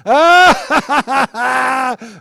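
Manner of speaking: high loud mock laugh; loud imitated laughter; angel laughing. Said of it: "like this".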